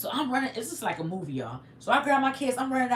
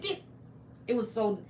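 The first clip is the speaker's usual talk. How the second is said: ordinary delivery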